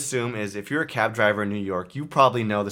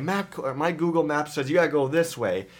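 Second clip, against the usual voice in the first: higher-pitched angry backseat directions; mock yelling; 7 semitones higher than usual. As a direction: imitate an irate passenger correcting the driver. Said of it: "they're, like, swearing and yelling at him".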